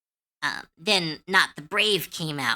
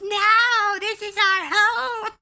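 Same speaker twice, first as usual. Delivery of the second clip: very high, slow goblin insisting; a small goblin standing its ground; high goblin voice, firm; 5 dB louder, much slower than usual. Retold or imitated. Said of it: "the goblin goes".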